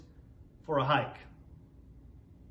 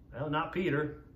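ordinary talk

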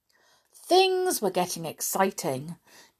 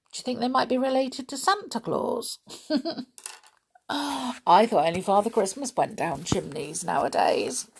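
amused; 4 semitones higher, 3 dB quieter, faster than usual. Real, not acted. Reader jokes after the story.

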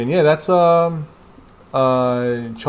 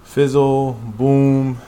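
louder sound effect voice; fizzle and boom; 3 semitones higher, 6 dB louder, much slower than usual. Acted. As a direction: punch out the effect. Sound effect read aloud.

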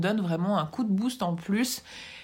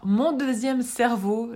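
ordinary delivery

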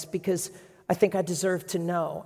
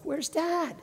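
high, sharp cry of panic; a frantic daughter's cry; mock scream; 12 semitones higher than usual. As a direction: call out like a scared girl. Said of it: "Chelsea screams at her".

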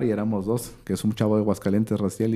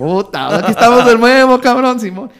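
much higher and louder, shouting with glee; excited greeting; 12 semitones higher, 10 dB louder than usual. Retold or imitated. Bumping into the eliminated guys from Mexico again, loud and high. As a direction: greet old rivals with a loud laugh in the voice.

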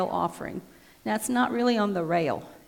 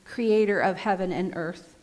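louder solemn liturgy reading; liturgical proclamation; 4 dB louder than usual. Acted. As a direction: proclaim the prayer to the hall. Great thanksgiving recited.

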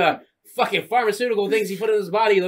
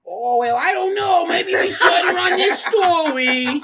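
high, slow, hesitant whine; a hammed-up timid reporter; whiny Eddie Brock; 10 semitones higher, much slower than usual. Eddie Brock backing down on the story, high whining. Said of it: "he's like".

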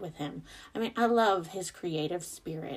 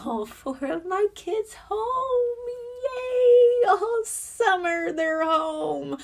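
sarcastic cheer; 9 dB louder, much slower than usual. Real, not acted. Mom sarcastically cheering kids home.

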